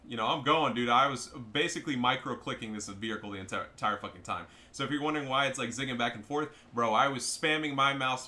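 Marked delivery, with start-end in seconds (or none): none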